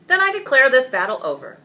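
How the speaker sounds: loud, high, fast proclamation; a king ending the battle before the town; royal declaration. Acted. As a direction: announce it to the crowd. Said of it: "the king announced".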